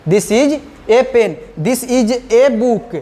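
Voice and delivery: a stressed as a full "ay", very slowly; emphasis on A. Shown as a demonstration. Teacher repeats emphatic examples for students, stressed "ay". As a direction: lean on a as "ay" in each example. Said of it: "किसी चीज़ को हमको emphasise करना है".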